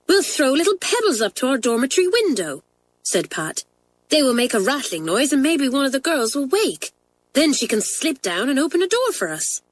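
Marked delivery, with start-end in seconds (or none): 0.1-2.6 higher, eager schoolgirl voice; a bright plan from a girl; lightly voiced girl. A narrator's reading. Pat proposing pebbles to her friends, eager and higher. 4.1-9.6 higher, eager schoolgirl voice; a bright plan from a girl; lightly voiced girl. A narrator's reading. Pat proposing pebbles to her friends, eager and higher.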